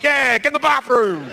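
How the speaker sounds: put-on high, drunk egging on; high drunken chime-in with a laugh; wasted cheering on. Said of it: "I'm so wasted, I'm getting involved".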